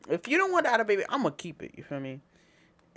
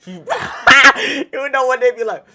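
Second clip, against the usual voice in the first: loud laughter breaking the words; laughing; 7 semitones higher, 14 dB louder than usual. Real, not acted. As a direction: laugh hard while talking. Laughing hard at own story.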